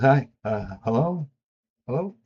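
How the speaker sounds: mock-nervous hello, rising each time; imitating a nervous boy on the phone; nervous stammer. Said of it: "getting all nervous again".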